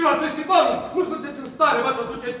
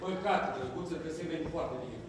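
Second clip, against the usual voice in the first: much softer and lower, cutting; icy put-down; 9 semitones lower, 14 dB quieter than usual. Acted. Actor coldly insulting the activist colleague, quiet and low. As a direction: drop the voice and slide the knife in.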